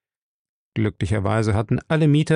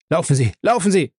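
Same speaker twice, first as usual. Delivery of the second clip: raised, higher urgent cry to flee; urgent call; 6 semitones higher, 3 dB louder, faster than usual. A narrator's reading. Elk warning Broad to flee the gas, shouting urgently. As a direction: call out to run, pitch up and pushed. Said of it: "rief er".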